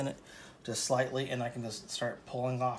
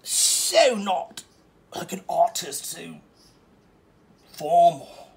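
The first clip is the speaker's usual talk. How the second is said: much higher and louder, mock snobbish critic; snooty critic voice; 6 semitones higher, 8 dB louder than usual. Painter imitating a snooty critic who finds him unpolished. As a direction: ham up a snooty art critic. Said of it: "You're like".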